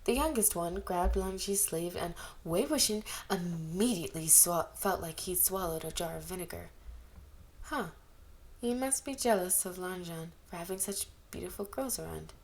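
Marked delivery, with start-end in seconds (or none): none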